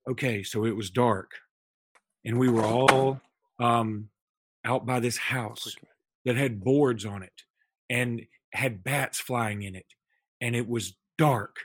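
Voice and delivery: slow, hushed ghost-story telling; retelling a kid's ghost story, slow and low; spooky storytelling. Retold or imitated. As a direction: draw them in with a creepy campfire tale. Son's spooky campfire story retold.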